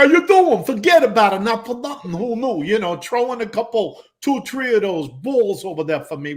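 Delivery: loud, high New York Italian wiseguy bluster; hammed-up New York Italian street talk. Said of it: "that stereotypical New York, you know, Italian thing".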